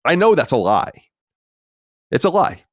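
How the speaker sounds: ordinary talk, nothing marked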